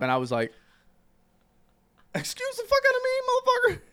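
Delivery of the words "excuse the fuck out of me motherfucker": very high-pitched, indignant retort; mock outrage. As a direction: act out being scandalized by the insult. Acts out outraged reply to DJ.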